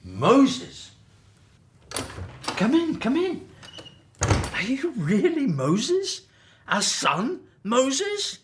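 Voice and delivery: high, rising cries of joyful disbelief; overjoyed disbelief. Acted. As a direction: greet a lost son in amazement. Father recognizes long-lost son.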